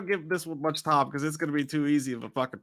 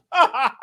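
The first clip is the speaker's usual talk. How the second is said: high loud relieved groan; tired groan; 8 semitones higher, 6 dB louder than usual. Retold or imitated. Describing coming home from work, loud groan. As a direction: groan like after a hard day. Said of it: "You sit there".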